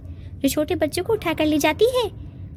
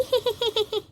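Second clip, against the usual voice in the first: giggle; 4 semitones higher, 4 dB quieter than usual. Acted. Rosie laughing at phone.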